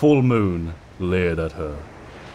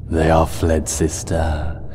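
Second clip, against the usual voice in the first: menacing monster voice; 3 semitones lower, slower than usual. Acted. Nightmare Moon gloating over Celestia's end, cold and menacing. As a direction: gloat over a doomed sister.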